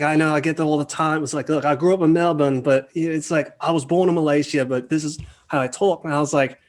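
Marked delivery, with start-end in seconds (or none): 0.0-1.2 put-on higher-pitched Australian accent; lightly mimicked Australian voice. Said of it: "with an Australian accent". 1.5-6.0 put-on higher, louder Australian accent; animated mock-Australian voice. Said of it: "with an Australian accent".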